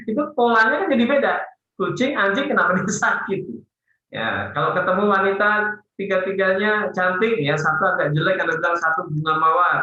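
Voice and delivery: normal talking voice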